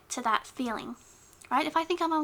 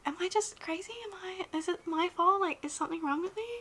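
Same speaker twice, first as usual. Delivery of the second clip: anxious whisper; 6 semitones higher, 4 dB quieter than usual. Retold or imitated.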